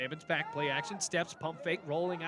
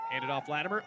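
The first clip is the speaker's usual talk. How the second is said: normal talking voice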